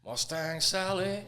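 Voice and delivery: calling the song to the bar; song title called out. Retold or imitated. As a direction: announce the tune to the room, stretched. Band count-in, calling the song.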